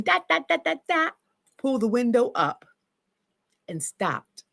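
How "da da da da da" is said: mock yelling.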